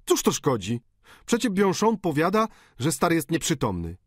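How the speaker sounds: high, mock-injured question; painter protesting. A narrator's reading. Painter protesting to Eugene, lightly mocking. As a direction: shrug off the rebuke.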